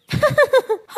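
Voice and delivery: mocking cackle. Thief crow mocking child.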